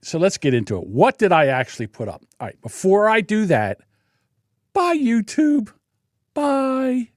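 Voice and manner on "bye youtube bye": high, slow sing-song farewell; mocking goodbye. Real, not acted. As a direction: wave them off mockingly. Mock farewell to YouTube.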